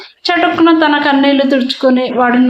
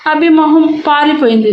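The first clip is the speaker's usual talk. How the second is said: ordinary delivery, nothing marked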